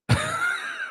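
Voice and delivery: very high, soft squeaky laugh. Real, not acted. Laughing after the story.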